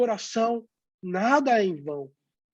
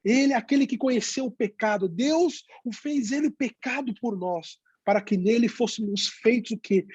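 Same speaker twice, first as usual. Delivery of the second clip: normal talking voice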